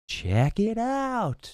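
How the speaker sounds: high and very slow, savouring the words; drawn-out salesman pitch. Retold or imitated. Car dealer tempting the buyer at the car, high and slow. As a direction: stretch it out like a dealer teasing a sale. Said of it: "and go".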